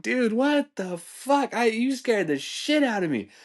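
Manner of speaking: high, drawn-out retold outrage; slow, high-pitched disbelief; shocked protest. Retells reaction to scare.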